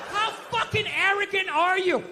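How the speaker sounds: ordinary talk, nothing marked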